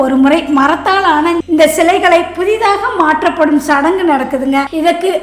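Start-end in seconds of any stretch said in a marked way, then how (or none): none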